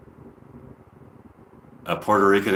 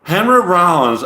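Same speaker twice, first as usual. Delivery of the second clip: higher, louder Southern twang; Southern accent; 7 semitones higher, 5 dB louder than usual. Acted. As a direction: say it like a hammed-up Southerner. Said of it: "I kinda like doing the Southern accents".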